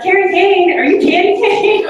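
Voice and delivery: high, loud, laughing "Carrie Kane" as a surprised question; delighted support staff. Retold or imitated. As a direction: imitate the staff recognizing her, giggly. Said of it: "They're like".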